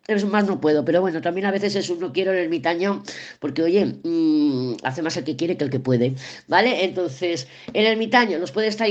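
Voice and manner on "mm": usual speaking voice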